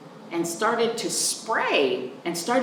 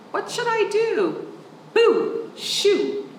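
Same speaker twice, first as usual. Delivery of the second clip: shooing; 13 semitones higher than usual. Acted. Child shooing squirrel.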